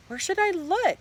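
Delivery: put-on high, whiny girl's voice; upset asking. A narrator's reading. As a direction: ask in a high, helpless whine. Sheila asks, upset, after crying.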